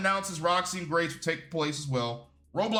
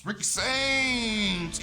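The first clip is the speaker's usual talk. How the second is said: stretched hype shout; 6 semitones higher, much slower than usual. Real, not acted. Calling out wrestler's entrance.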